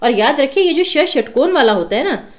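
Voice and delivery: usual speaking voice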